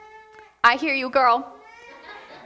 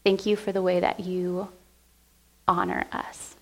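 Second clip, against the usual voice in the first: prayer. Real, not acted. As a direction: pray gently to Jesus. Pastor leads closing prayer.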